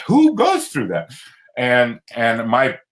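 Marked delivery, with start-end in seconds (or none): 0.1-1.1 lightly voiced psychiatrist, higher and slow in disbelief; stunned psychiatrist. Retelling the psychiatrist's reaction, a high, slow, stunned question. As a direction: play the doctor who can't believe it. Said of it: "He said".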